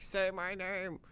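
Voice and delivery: high, drawn-out desperate plea; mock desperate plea, slow and high; pleading beggar. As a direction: stretch it out like a pitiful wretch in the mud. Dragged man begging.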